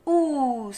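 "Ous" with long vowel gliding into a held s; inverted syllable. Shown as a demonstration. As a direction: stretch "Ous", vowel first, then a long hiss. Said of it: "le son voyelle est devant".

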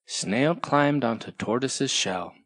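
usual speaking voice

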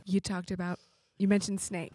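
low, very soft voice; hushed scolding aside. Acted. Friend privately warning Harry about his slip, hushed. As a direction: confide it under the breath.

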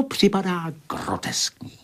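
high whispered aside. Acted. Thinker lecturing Marcela, ending in a whisper. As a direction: let the sentence sink to a whisper.

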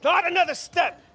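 very high, loud threat; shouted warning. Acted. Gunman holding off an approaching enemy, shouted warning. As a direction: shout to stop them in their tracks.

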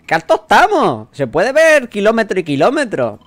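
high, loud exclaiming voice; excited character. Acted. Game character looking out from a great height, excited. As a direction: cry it out in acted amazement.

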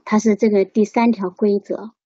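ordinary delivery

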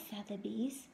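lively storybook read-aloud voice; reading to children. A narrator's reading. Teacher reading a picture book to her class, lively. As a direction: read it out brightly to the little ones.

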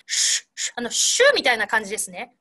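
"シュッ" as voiceless puffs of sh; warm sh sound. Shown as a demonstration. Teacher contrasting sh with s, "シュッ" as warm hushing breath. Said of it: "ちょっと温かいイメージ".

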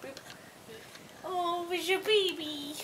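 put-on hushed playful baby voice; baby talk. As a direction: coo a question at a puppy. Baby-talking to the dog.

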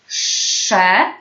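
"Sze" with a long hushing sz before the vowel; drawn-out SZ. Shown as a demonstration. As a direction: model the syllable "Sze", sz held long.

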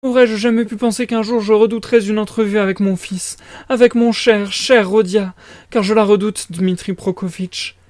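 high-pitched flustered mother; fretful mother character. Acted. Voices the mother.

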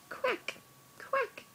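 very high squeaky quack; duck quack. Retold or imitated. Parent to baby, high duck quack. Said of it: "Duck says".

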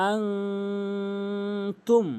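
আংতুম with a very long nasal hum; drawn-out humming আংতুম; long ghunnah. Shown as a demonstration. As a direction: hold the nasal of আংতুম before releasing tum. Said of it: "গুণনা করতে হবে".

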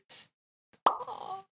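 whispered through laughter; breathy laughing stop. Real, not acted.

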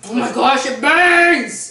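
real pain, loud, pitch jumping up; pained yell. Real, not acted. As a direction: yell as the heat hits. Ghost pepper burning his mouth.

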